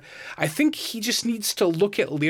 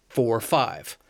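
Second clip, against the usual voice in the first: ordinary talk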